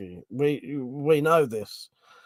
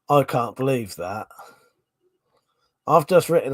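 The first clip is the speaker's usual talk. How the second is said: normal talking voice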